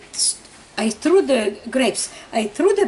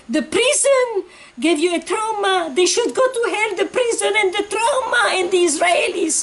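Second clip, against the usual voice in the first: accusing; 9 semitones higher than usual. Retold or imitated. Husband blaming wife for the dead baby, furious. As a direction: hurl the blame at her.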